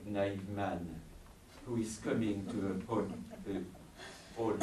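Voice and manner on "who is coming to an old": usual speaking voice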